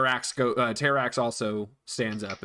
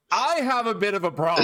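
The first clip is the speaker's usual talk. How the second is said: rabbit voice; 9 semitones higher, 4 dB louder than usual. Retold or imitated. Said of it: "blood-soaked rabbit just standing up and going".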